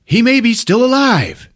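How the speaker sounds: high, hammed-up urgency; dramatic hope. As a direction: exclaim with sudden mock-heroic hope.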